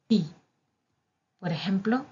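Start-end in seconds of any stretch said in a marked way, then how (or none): 0.1-0.3 "ti" said with an ee vowel; Italian letter name. Shown as a demonstration. Teacher showing the Italian T, clear letter-name demo. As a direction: say "ti" like tee. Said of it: "La letra T en italiano es".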